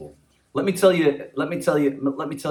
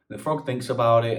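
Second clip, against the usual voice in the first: usual speaking voice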